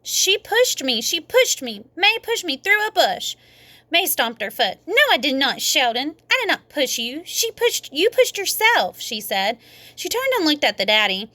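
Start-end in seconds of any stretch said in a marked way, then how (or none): 0.0-3.4 put-on high, upset child's voice; sputtering accusation. A narrator's reading. Sheldon accusing May to the daddy, high and upset. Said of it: "He sputtered and stuttered". 4.9-9.0 put-on high, bossy little girl; indignant denial. A narrator's reading. May snapping back at Sheldon, high and indignant.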